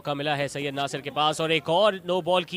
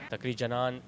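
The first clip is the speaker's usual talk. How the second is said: ordinary delivery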